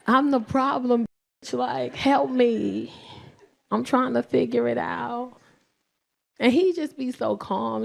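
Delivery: ordinary talk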